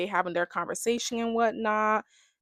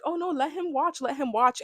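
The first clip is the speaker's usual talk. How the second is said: enjoying it; 7 semitones higher than usual. Retold or imitated. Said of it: "Kata was enjoying it a bit".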